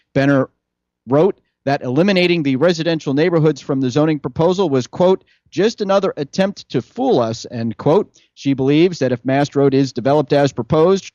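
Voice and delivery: brisk-sounding local news anchor delivery, pitched slightly up; punchy, projected newsreader voice; newscast read. Real, not acted. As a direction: read it out like a radio news bulletin. Reads the newscast.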